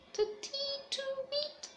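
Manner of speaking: very high, slow piping toot; bird call. Acted. Narrator voicing the lapwing in the grass, slow high piping. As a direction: sing it out like a small bird.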